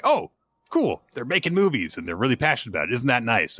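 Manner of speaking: quick, lightly hammed-up enthusiasm; mock approval. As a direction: act out an easily pleased fan. Voicing an approving reader.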